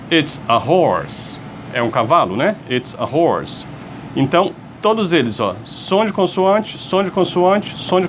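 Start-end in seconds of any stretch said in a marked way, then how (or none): 0.1-1.4 slow, clear model sentence for class; slow model phrase. Shown as a demonstration. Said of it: "model phrase for class". 2.7-3.7 slow, clear model sentence for class; slow model phrase. Shown as a demonstration. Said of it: "model phrase repeated".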